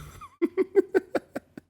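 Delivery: very high delighted laugh; high squealing laugh. Real, not acted. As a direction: burst out laughing at your own impression. Laughing at the impression.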